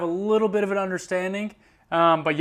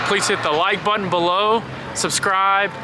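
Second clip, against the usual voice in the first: brisk, louder call to subscribe; upbeat promo plug; 3 dB louder than usual. Acted. Vlogger pops in to plug her channel, brisk and upbeat. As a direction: rattle off the plug quickly.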